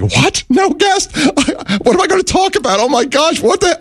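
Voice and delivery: high, frantic panic; panicked inner scream. Retold or imitated. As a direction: squeal it in pure panic. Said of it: "In my head, I'm screaming".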